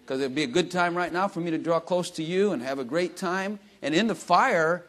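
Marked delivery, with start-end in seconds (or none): none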